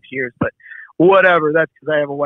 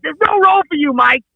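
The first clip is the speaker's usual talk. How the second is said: high, loud mock shout; imitating an exasperated producer; exasperated refusal; 11 semitones higher, 5 dB louder than usual. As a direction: shut down a pest, voice pitched way up. Acted refusal in retold argument.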